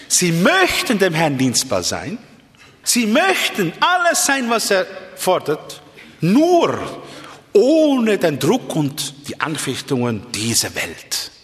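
emphatic preaching with high-pitched peaks; impassioned preaching. Real, not acted. Preacher describes Christians avoiding pressure, impassioned preaching. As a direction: punch the key words high, then slow down.

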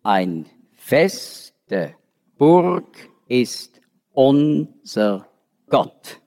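slow, syllable by syllable, each beat even; counting every syllable. Shown as a demonstration. Teacher reciting a hymn line to show German syllable counting. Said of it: "jede, jede Silbe".

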